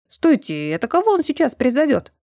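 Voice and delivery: sudden wary alarm. A narrator's reading. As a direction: cut in, suddenly on guard. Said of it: "насторожился Вирфальц".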